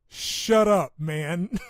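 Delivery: playful shouted shut up; laughing shout. Real, not acted. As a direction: yell it back at friends, cracking up. Laughing retort to friends.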